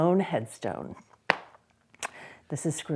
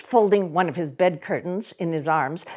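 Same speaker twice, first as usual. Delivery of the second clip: ordinary delivery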